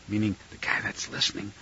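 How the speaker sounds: very soft, low aside; hushed aside. Real, not acted. Teacher explains the verse mid-quote, hushed aside. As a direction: drop almost to a whisper for a side note.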